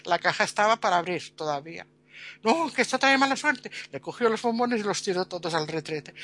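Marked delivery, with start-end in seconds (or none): 2.4-3.7 nervous excuse. Retold or imitated. Hastily stops her.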